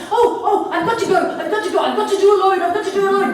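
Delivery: loud, high, rapid babble; hammed-up desperate urgency; urgent pleading girl. Girl tells wolf she must go outside, loud urgent plea.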